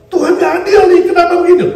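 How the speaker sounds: loud, high whiny grumble at God; hammed-up indignant whine; mock complaint. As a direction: complain like a sulking believer.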